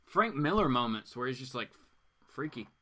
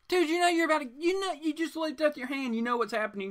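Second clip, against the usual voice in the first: exasperated rant; 12 semitones higher than usual. Real, not acted. Host scolding the wimpy character, exasperated. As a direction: scold him in disbelief.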